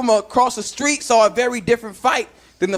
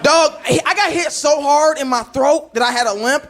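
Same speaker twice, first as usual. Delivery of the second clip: usual speaking voice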